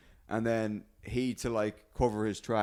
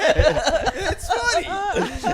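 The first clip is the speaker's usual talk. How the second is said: high, slow goofy voice; high silly voice; 7 dB louder, much slower than usual. Pretend. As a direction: stretch it out in a dopey high voice. Repeats in silly voice.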